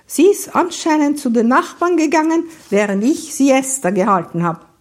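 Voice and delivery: high, loud, indignant delivery; imperious woman. Acted. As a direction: keep up the schoolmistress's ringing tone. Same imperious figure keeps talking.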